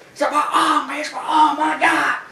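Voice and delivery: high, loud hammed-up wailing; mock agony. Big Show with his arm in the steps, mock pained cries. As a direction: wail over the injury, half laughing. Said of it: "And Big Show's like".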